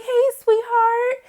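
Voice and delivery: put-on slow, high and gentle, to a child; tender greeting. Father greeting his little daughter, slow, high and tender. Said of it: "He was like".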